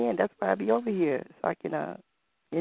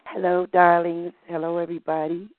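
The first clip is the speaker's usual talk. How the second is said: campy drag queen; 5 dB louder than usual. Acted. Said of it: "the all-around queen talking".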